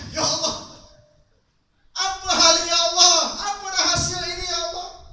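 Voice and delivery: high, pleading lament to God; crying prayer. Acted. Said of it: "Nangis dia". (ratapan menangis, suara tinggi)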